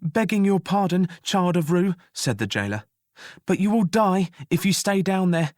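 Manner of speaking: high, hurried reassurance; jailer voice. Acted. Jailer reassuring the prisoner before fleeing the fire, high and hurried.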